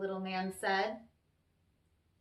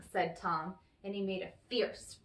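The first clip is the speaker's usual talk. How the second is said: usual speaking voice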